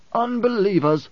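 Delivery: high-pitched cry of protest; indignant protest. A narrator's reading. Korba protests at his emperor's account of the Jihad, high protest. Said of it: "Korba protested".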